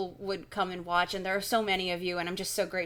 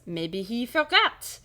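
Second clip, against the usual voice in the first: slow, higher mock French accent; 4 semitones higher, 6 dB louder, slower than usual. Shopkeeper shrugging off the kids' question, French accent. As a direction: drag it out in a put-on French voice. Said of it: "I've decided he's French".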